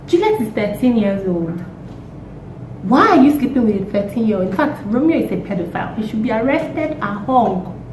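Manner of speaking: normal talking voice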